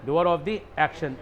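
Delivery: each word drawn out slowly for the class; slow dictation. Shown as a demonstration. Teacher slowly models the answer for the class. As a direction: spell it out slowly so students repeat. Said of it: "बात समझ में आ गई ना?".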